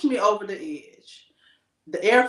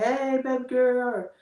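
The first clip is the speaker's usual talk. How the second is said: gentle greeting; 4 semitones higher, slower than usual. Retold or imitated. Husband calling his upset wife, slow tender greeting. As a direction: greet her tenderly, drawn out. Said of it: "You like".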